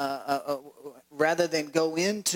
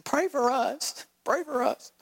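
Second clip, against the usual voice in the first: high, slow, frightened begging; panicky plea; 9 semitones higher than usual. Acted. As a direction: beg for your life in a storm. Disciples beg in the storm.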